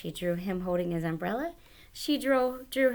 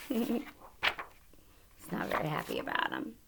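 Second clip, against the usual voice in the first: usual speaking voice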